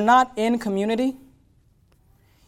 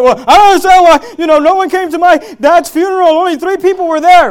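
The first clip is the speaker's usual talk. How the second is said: loud, high indignant whine; mock upset griever complaining about the funeral; upset complaint; 9 semitones higher, 12 dB louder than usual. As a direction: mimic a bitter mourner's grievance. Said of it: "And people get upset".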